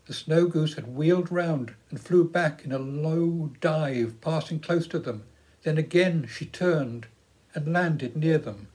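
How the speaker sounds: usual speaking voice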